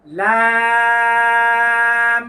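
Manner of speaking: slow drawn-out লাম with the tongue; letter name Lam. Shown as a demonstration. Teacher drawing out লাম for students. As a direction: stretch লাম long and clear for students to copy. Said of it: "আয়না দেখে দেখে বলুন".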